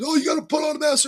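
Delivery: high, quick mock whine of a mask scold; mocking mask nag. Caller mocks Walmart shoppers nagging about masks, high and quick. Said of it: "they'd be going".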